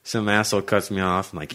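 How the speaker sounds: ordinary talk, nothing marked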